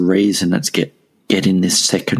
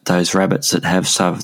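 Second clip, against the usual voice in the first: normal talking voice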